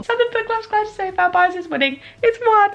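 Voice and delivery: high-pitched, thrilled squeal of news; real excitement. Real, not acted. Announcing the poll winner to viewers, very excited. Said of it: "I'm so excited".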